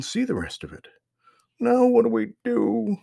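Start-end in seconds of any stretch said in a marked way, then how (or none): 1.6-3.0 put-on much higher, louder child's wail; wailing little girl. A narrator's reading. Lost sister wails to her brother, high wail. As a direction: wail it out like a lost scared kid. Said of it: "wailed Lauren".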